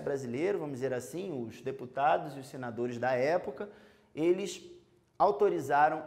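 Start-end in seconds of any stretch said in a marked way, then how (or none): none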